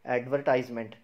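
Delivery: "एडवर्टाइजमेंट" ending in "tize-ment"; "eye" sound in the middle of "एडवर्टाइजमेंट"; common mispronunciation. Shown as a demonstration. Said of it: "एडवर्टाइजमेंट भी नहीं बोलते".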